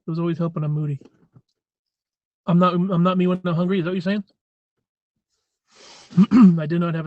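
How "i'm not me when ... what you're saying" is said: usual speaking voice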